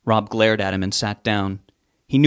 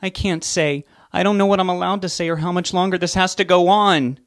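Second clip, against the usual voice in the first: raised, high, anxious voice; speaking loudly; 9 semitones higher than usual. A narrator's reading. Frightened woman speaking loudly to Rob. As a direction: she blurts it out loudly. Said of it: "She was speaking very loudly".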